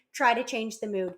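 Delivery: ordinary talk, nothing marked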